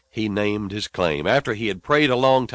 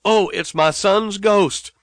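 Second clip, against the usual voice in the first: mock scream; 8 semitones higher, 3 dB louder, slower than usual. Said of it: "the old mama screamed out".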